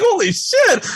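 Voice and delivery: cracking up in disbelief; laughing shout. Real, not acted. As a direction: shout it high through laughter. Laughing at hang-up calls.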